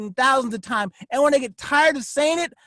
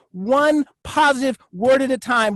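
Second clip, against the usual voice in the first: ordinary talk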